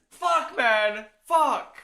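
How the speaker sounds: high, sharp cursing; frustrated outburst. Real, not acted. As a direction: curse out loud at the mess. Swearing over the spilled mess.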